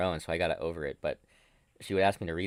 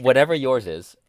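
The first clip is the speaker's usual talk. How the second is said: louder, higher, pushed through a laugh; laughing emphatic repeat; 6 semitones higher, 7 dB louder than usual. Shown as a demonstration. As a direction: insist on it, laughing. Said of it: "It's in a different country".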